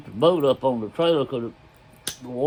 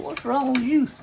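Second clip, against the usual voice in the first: much higher, incredulous question; baffled retort; 12 semitones higher than usual. Retold or imitated. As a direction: retell your bewildered reply to a panicking friend. Baffled reply to panicking friend.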